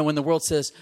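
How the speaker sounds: ordinary talk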